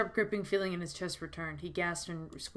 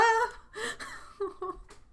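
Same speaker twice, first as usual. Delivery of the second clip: high crying laugh. Real, not acted. Streamer laughing at her own melodrama, a high crying laugh. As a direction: laugh breaking into a sob.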